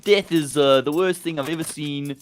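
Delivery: high, loud, overdone South African accent; exaggerated South African, high and loud; strong South African accent. Shown as a demonstration. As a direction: ham up the South African vowels. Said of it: "strongest South African accent".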